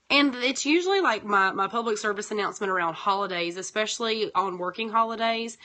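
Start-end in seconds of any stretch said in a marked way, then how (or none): none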